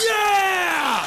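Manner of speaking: high shouted cheer; crowd cheer. Retold or imitated. Everyone in the club cheering Ken, high shouted yeah. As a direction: roar it like a whole room.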